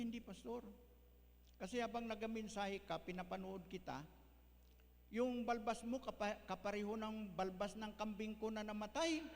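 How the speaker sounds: lightly voiced old member, rising to a loud high punchline; old church member. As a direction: retell her answer innocently, then burst out on the last line. Old member explains her crying.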